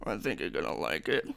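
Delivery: very low, soft murmur; hushed aside. Real, not acted. Confiding to viewers after fanboy outburst, hushed and low. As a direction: drop right down as if confiding.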